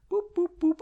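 high-pitched boop boop boop like a buzzer; mock beeping alarm. Signalling a drinking break to listeners, high boops.

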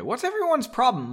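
mildly irritated, high-pitched question; angry. A narrator's reading. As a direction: read it high with a touch of anger. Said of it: "now angry".

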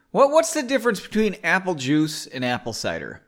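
ordinary delivery, nothing marked

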